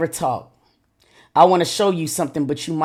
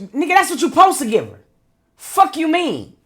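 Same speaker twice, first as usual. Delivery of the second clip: high-pitched indignant outburst; outraged; 10 semitones higher, 3 dB louder than usual. Real, not acted. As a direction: fire back in disbelief, pitch way up. Outraged reaction to the message read.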